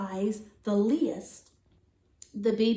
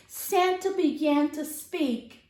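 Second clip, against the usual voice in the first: plain reading voice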